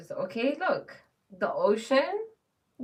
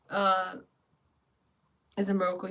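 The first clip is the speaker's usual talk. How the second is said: usual speaking voice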